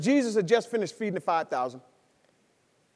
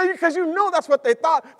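ordinary talk

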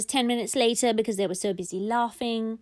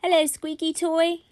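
put-on higher-pitched child's greeting; little boy; 7 semitones higher, 4 dB louder than usual. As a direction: greet a toy like a small boy. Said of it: "he said something to the squeaky toy".

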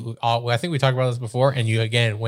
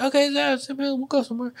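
high-pitched lukewarm agreement; mock half-hearted agreement; 14 semitones higher than usual. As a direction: shrug it off in a squeaky voice. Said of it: "they're usually like".

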